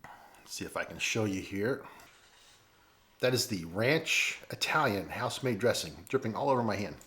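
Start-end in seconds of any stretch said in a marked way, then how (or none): 0.0-1.8 hushed, busy with his hands; whispered aside. Real, not acted. Vlogger whispers while showing viewers the dressing. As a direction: murmur it under his breath while showing.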